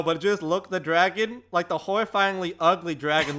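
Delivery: ordinary delivery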